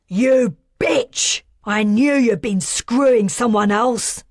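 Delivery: loud, furious male voice shouting; jealous rage. A narrator's reading. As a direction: spit out the accusation in fury. Jealous man confronting lovers.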